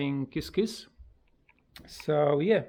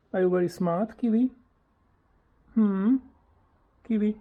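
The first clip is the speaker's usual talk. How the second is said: high, louder cooing question; pet talk; 6 semitones higher, 6 dB louder, faster than usual. Real, not acted. Owner asking parrot if he's smart, high cooing pet talk.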